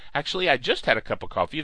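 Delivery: fast, rushed jittery patter; caffeinated rush. Real, not acted. Host jokes about his coffee, rushing fast. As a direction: rattle it off like too much coffee. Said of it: "coffee does these things to you".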